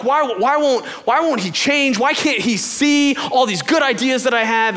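high, pleading exasperation; mock freak-out. Venting about the boss who won't change, mock freak-out. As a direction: whine it out in exaggerated frustration. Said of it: "I'm freaking out and I'm going like".

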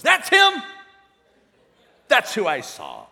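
loud mock cry of recognition, starting very high; shouted recognition. Prophet lady recognises the man at the door, shouting. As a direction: burst out on the first words, then drop.

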